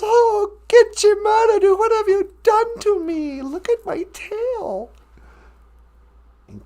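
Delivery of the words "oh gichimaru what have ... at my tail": high, loud wail over ruined tail; crying beaver. Acted. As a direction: cry out to the Creator, mock-tearful. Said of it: "and he started to cry".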